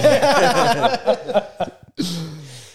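high, bright burst of laughter; happy laugh. Real, not acted. Quiz player laughing after his cheer. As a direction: laugh out loud at your own win.

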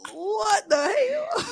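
slow, high, drawn-out "what the hell"; reacting to the show's fight, a stretched shocked shout. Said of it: "I'm like".

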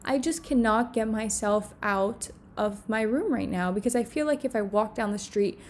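normal talking voice

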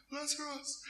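soft, high acted sobs; sob, then whimper the name; quiet tearful imitation of grief; crying. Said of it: "and he's crying".

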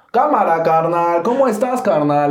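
high, sing-out barrio Mexican greeting, the opposite of fresa; non-fresa Mexican accent. Acted. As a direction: exaggerate a street-style Mexico City accent. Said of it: "cómo habla alguien que no, que no sea fresa".

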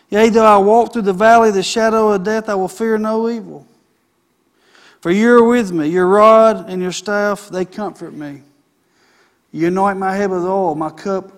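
loud, proclaiming scripture read; preached scripture reading. Acted. Pastor reading Psalm 23 to the church, loud and proclaiming. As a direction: ring out the psalm to the congregation.

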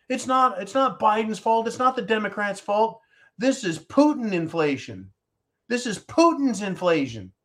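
high-pitched parroted excuses; mocking Democrats' excuses. Host imitates afraid Democrats shifting blame, high mock protest. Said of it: "they're afraid".

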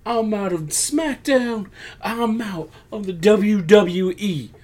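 high, slow mock proclamation; dramatic declaration. Wrestler announcing to the crowd he quits, dramatic declaration. Acting Jay's quitting announcement.

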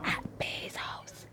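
slow teasing whisper. Real, not acted. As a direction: whisper it like a secret.

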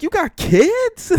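very high, loud, drawn-out question; high-pitched incredulous outburst; shocked disbelief. Retold or imitated. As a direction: stretch it out in loud astonishment. Acting out shocked reply to date.

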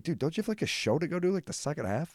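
high, disbelieving question; incredulous. Retold or imitated. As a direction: ask him in high disbelief. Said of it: "I'm like".

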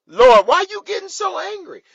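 high, loud mock-indignant shout; hammed-up Moses. Moses challenging the Lord over his anger, loud high mock outcry. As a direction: yell it up high, hamming up the complaint. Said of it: "You know what Moses says?".

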